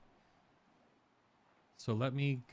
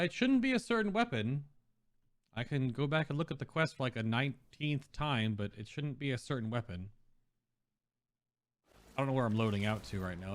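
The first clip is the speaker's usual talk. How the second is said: ordinary talk, nothing marked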